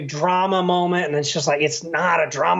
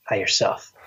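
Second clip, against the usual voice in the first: movie greeting bit; 9 semitones lower than usual. Acted. Capping the deathbed joke, low mock-movie voice. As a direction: drop low, almost voiceless.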